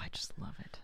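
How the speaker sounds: soft, whispered adoration; breathy swoon. Real, not acted. Host admits loving British accents, in a whispered swoon. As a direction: sigh it out in a near whisper.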